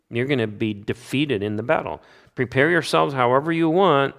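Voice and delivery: ordinary delivery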